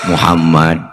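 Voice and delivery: low and steady; calm low answer. Retold or imitated. The Prophet names himself at the sick man's door, low and calm. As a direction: say your name low and patient.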